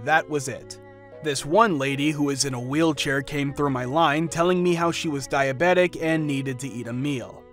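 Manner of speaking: plain reading voice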